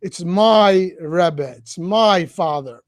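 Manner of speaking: my drawn out, said very slowly; slow emphasis on my. Shown as a demonstration. Urging listeners to feel personal closeness, slow stress on my. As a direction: let each my sink in. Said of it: "Try to train yourself".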